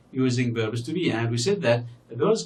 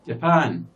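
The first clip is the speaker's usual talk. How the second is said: Japan with a louder, drawn-out second syllable; stress on second syllable; 5 dB louder than usual. Shown as a demonstration. Teacher showing learners the stressed syllable, second-syllable stress in Japan. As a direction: hit the end of Japan hard, letting it fall. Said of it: "the second syllable here is stressed".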